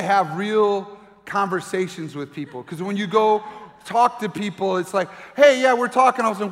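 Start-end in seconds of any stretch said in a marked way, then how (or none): 5.3-6.2 bright rushed voice, louder and higher; hurried parent chatter. Retold or imitated. Parent of toddlers trying to chat, raised and hurried. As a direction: mimic a distracted parent talking fast.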